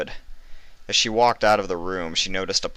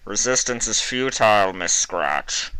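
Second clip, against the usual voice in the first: cold interrogator; 4 semitones higher than usual. A narrator's reading. Interrogator over the speaker, cold official. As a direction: question her with detached authority.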